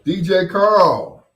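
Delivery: loud, slow, high call to a listener; calling out a shout-out. Real, not acted. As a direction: stretch the name out like a DJ on air. Radio host shouting out listeners.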